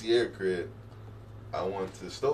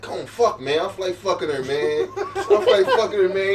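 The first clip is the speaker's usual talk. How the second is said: raised, loud pleading with a laugh; pleading friend; 10 semitones higher, 6 dB louder, slower than usual. Retold or imitated. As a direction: beg him to come, voice up high. Begging friend on phone.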